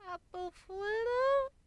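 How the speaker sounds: very high, slow, deflated foal voice; tiny foal, let down; disappointed baby. A narrator's reading. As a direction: let the hope drain out. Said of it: "in a much less enthusiastic tone".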